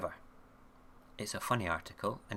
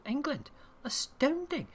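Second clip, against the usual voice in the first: much higher, louder mock astonishment; mock amazement; 4 dB louder than usual. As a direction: ham up the amazement. Mock amazement at a news story.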